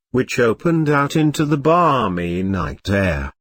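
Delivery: plain reading voice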